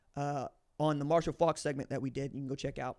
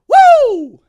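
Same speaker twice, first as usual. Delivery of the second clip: very high, very loud whoop; excited woo; 22 dB louder than usual. Real, not acted. Host hyping up the Ohio Players, loud whoop. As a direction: let out a hype yell. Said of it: "hyped about album covers".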